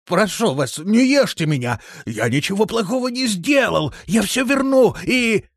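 high, loud, panicked pleading; desperate wailing plea; hysterical begging. A narrator's reading. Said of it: "упал на колени и стал умолять".

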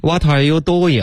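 mock-mangle "What are you doing" in Chinese syllables; "doing" turned into duo-yang, syllable by syllable; wrong pronunciation. Shown as a demonstration. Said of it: "绝不能这么说了".

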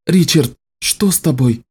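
high, anxious question; concerned female character voice; worried. Acted. Nicci worried over Richard.

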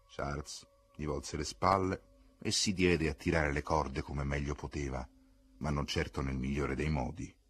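ordinary delivery

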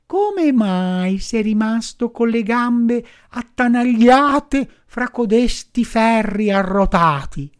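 high, slow, tender firefly voice; pitying firefly. Acted. Firefly questions Pinocchio.

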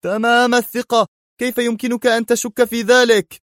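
yell, offended by the doubt; angry shout. Acted. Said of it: "في غضب وهو يهتف".

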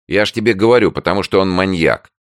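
high, loud impatient insistence. A narrator's reading. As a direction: push the point, impatient. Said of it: "сказала Танька нетерпеливо".

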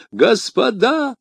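high, slow, desperate cry of Gentlemen; a terrified shopkeeper wailing for help; высоким голосом, с мольбой; wailing plea. A narrator's reading. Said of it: "возопил он".

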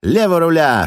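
high, loud desperate yell; shouting at the top of lungs. Acted. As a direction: scream the order with all you have. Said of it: "что есть мочи, закричал я".

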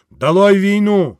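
agitator's cry; rally cry. Acted. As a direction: shout the slogan to the crowd. Slogan at speech's peak.

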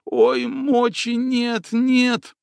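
high, slow whimpering wail; whining man. Acted. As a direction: whine like a coward in pain. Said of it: "законючил масластый".

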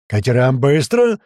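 loud, slow, drawn-out command; yelled order. Acted. Narrator sends men to the boats, yelled order. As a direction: bellow it to the whole group. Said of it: "заорал я".

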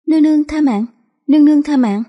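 high-pitched frightened begging; pleading for mercy. A narrator's reading. Prison guard begging the empress for his life, high and pleading.

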